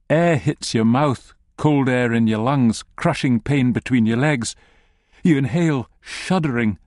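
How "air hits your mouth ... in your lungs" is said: usual speaking voice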